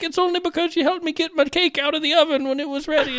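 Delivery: high, gushing mock credit; gracious winner. Susan crediting the loser, high mock gushing. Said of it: "she'll be like".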